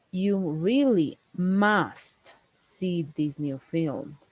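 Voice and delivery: slow, with "must" leaned on; "must" pressed in a slow read; urging, "must" stressed; emphatic must. Shown as a demonstration. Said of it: "Let's please hear these other times when we add emphasis".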